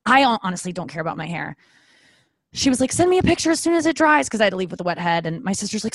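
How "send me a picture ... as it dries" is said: high, eager request; mock eager hairdresser. Hairdresser eagerly asking for a photo. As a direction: play the hairdresser keen to see it.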